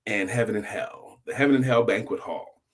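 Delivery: ordinary delivery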